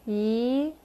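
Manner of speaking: "yi" rising like a question; second tone. Shown as a demonstration. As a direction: lift the end of "yi". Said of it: "bring it up a bit like a question mark".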